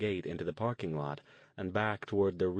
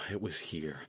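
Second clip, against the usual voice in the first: lower, softer sighing words; 4 semitones lower, 6 dB quieter than usual. A narrator's reading. Jason at the empty parking spot, sighing. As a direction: breathe out the disappointment. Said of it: "Jason said with a sigh".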